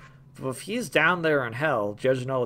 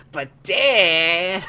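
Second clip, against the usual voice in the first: high, loud whiny "But Dad!"; Bobby Hill impression; 6 semitones higher, 7 dB louder than usual. Gamer doing Zagreus as Bobby Hill, whining loudly. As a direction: whine it like a put-out kid. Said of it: "he talks like Bobby Hill".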